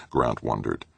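plain reading voice

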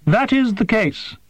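high, precise voice of a small winged creature; formal, formal alien creature, pitched high. A narrator's reading. Creature Prath speaks.

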